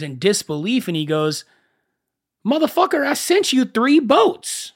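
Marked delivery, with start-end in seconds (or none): 2.5-4.7 God losing patience with a fool; a hammed-up divine rant; exasperated God. God's exasperated punchline.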